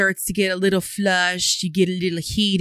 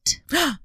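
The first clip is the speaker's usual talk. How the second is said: mock shocked gasp; 8 semitones higher than usual. Real, not acted. Shocked reaction to word.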